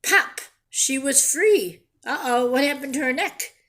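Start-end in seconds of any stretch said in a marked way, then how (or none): 0.0-1.8 storybook voice, lively and clear; reading to children. A narrator's reading. Teacher reading a picture book to her class, lively storytelling. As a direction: read it like a picture book to kids.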